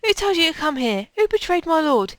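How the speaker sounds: interrogate, then threaten death; hostile game villain. Acted.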